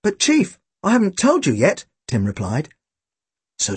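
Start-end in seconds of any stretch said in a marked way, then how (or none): 0.0-1.8 put-on high-pitched boy's protest; higher, eager objection. A narrator's reading. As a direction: plead with the chief in a raised boyish pitch. Boy Tim protests to chief.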